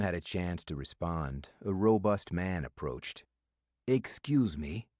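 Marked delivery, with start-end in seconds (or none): 3.9-4.9 put-on a slow, heavy Russian accent on "Excuse me"; thick Russian accent. A narrator's reading. Robust Russian man interrupting at the bar, thick Russian accent. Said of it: "in a thick Russian accent".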